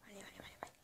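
breathy, barely audible whisper. Real, not acted. Gamer whispering to herself mid-match, whisper. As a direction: whisper it to yourself. Player mutters under breath.

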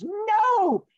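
drawn-out, high, loud cry; high falsetto shout of dismay; panicked rabbit. Acted. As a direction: wail it in hammed-up cartoon alarm. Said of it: "and the rabbit goes".

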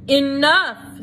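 loud sharp order; stern command. A narrator's reading. Head mouse silences the crowd, loud stern order. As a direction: cut the crowd off.

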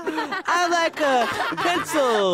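high, very slow silly parody; Mister Lynch as Junior. Acted. Coach wants teacher as other kid, mocking impression. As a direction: stretch it out in a mock-kid voice. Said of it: "Mister Lynch as the other kid".

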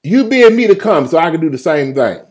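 high, loud bold appeal; bold Peter. Acted. Peter challenges the Lord from the boat, loud and high. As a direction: call up boldly to the Lord. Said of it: "Peter said".

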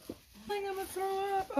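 put-on high queasy child voice; nauseated child. Sick child warns mom she'll throw up, high and queasy. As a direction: sound like you're about to be sick.

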